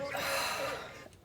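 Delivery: long, high sigh of being moved; sigh, overcome with feeling; heartfelt wordless exhale; emotional sigh. Real, not acted. Overcome by the joyful footage.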